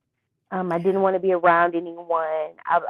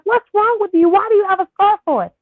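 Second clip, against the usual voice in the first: high, loud, bewildered questions; retold teen alarm, pitched up and louder; confused demanding; 12 semitones higher, 5 dB louder than usual. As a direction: push her for an answer, alarmed. Retold pressing mom in confusion.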